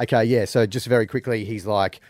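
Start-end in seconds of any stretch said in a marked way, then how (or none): none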